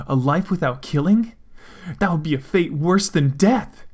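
high loud indignant cry; shouting. Acted. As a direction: cry out in horror. Shouting in outrage at Death.

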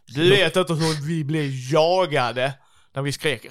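loud, high, worked-up storytelling; excited shouted retelling. Acted. Friend recalls being chased as kids, excited shouting. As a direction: relive the chase at full volume.

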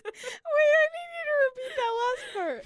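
high, loud and fast, laughing through it; begging her to repeat it mid-laugh. Real, not acted.

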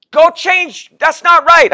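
wife shaking her head at his clothes; wife's voice. Retold or imitated. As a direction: mimic the wife rejecting the outfit. Wife voiced in marriage story.

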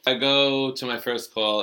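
ordinary talk, nothing marked

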